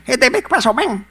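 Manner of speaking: high, loud Cuban greeting, laughing at the end; Cuban Spanish greeting. Retold or imitated. Recalling the Latin guys' greeting while laughing. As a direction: fire it off fast like the locals. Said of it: "when they were saying".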